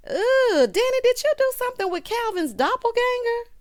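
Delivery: high-pitched squealing disbelief; playfully shocked, voice way up high; scandalized gasp. Real, not acted. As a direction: squeal the question, loud Ooh first. Scandalized reaction to the gossip.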